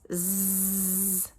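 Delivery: "zzz" as a long, steady buzz; continuous voiced buzz on "zzz"; stretched-out z. Shown as a demonstration. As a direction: hold "zzz" evenly with no vowel. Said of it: "This sound says".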